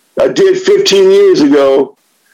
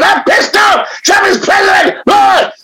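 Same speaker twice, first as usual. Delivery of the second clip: higher, mock angry yell; angry friend; 13 semitones higher than usual. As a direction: play the furious friend, hamming it up. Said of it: "he was pissed off".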